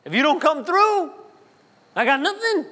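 high, pleading mock grievance; complaining prayer. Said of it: "full of complaining and grumbling".

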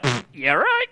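mock sassy Jesus, scoffing; sarcastic attitude. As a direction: brush it off with sarcasm. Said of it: "got a little attitude like".

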